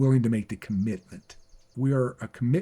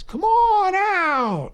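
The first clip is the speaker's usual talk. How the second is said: slow, drawn-out Southern drawl, pitched much higher; Lewis Mobley Southern accent; 4 dB louder, much slower than usual. Retold or imitated. Mentor from Georgia drawls an invitation in a slow Southern accent. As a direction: stretch every word like a Georgia gentleman. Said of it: "he had this real strong Southern accent".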